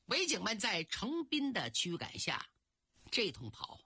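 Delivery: ordinary delivery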